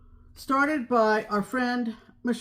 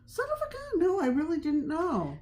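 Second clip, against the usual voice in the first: high-pitched astonishment, easing down; real surprise; 8 semitones higher than usual. Real, not acted. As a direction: react amazed at being corrected. Learns she was wrong about fabric maker.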